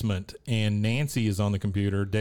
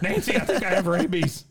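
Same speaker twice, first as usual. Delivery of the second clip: mock panicked Dale; 9 semitones higher, 3 dB louder than usual. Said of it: "He goes".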